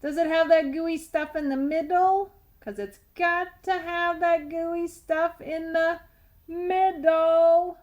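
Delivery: loud, high greedy tooth bellowing for goo; greedy tooth hollering for candy. Acted. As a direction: demand the gooey middle at full volume. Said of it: "Very loud Sweet Tooth".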